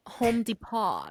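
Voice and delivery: "Home Depot" said slowly as "Home Depó", Spanish stress on the end; slow Spanish-style "Home Depot", last syllable "po"; Spanish accent. Retold or imitated. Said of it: "she'll go".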